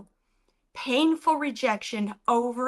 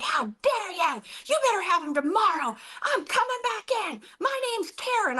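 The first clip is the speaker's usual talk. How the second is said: high-pitched mock outrage, climbing higher; imitated angry yelling; 9 semitones higher than usual. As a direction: play the irate customer, rising to a squawk. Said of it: "She starts yelling at me".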